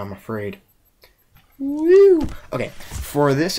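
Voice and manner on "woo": high shrill whoop; excited whoop. Real, not acted. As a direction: let out a big woo. Sudden cheer.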